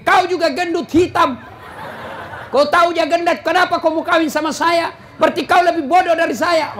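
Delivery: 1 mock quarrel. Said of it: "Nabi bilang juga istrinya".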